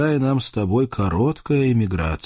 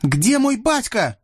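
казачка; 14 semitones higher than usual. Acted. Казачка требует ответа.

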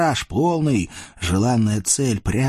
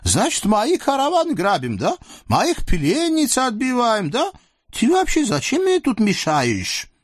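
lecherous sultan; 13 semitones higher than usual. Acted. Sultan leers at the captive women, high and gloating. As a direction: purr over the captives like a greedy sultan.

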